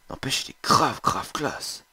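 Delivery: ordinary delivery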